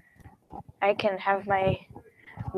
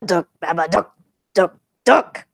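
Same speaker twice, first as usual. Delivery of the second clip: punchy staccato monster call, louder at the end; Babadook; 4 dB louder than usual. Recalling how the Babadook sounds, punchy imitation. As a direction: imitate a creature's knocking croak in short bursts. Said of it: "how the Babadook sounds like".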